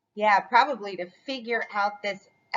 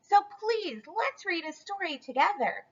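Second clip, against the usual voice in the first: put-on high, soft begging child; lightly voiced pleading little chick; 7 semitones higher, 6 dB quieter than usual. A narrator's reading. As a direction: coax Papa sweetly.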